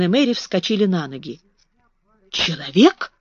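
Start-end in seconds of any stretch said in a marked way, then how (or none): none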